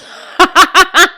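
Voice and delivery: loud high burst of laughter; sudden high laugh at your own slip. Real, not acted. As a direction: crack up in a quick, loud cackle. Laughing at forgetting to text Alex.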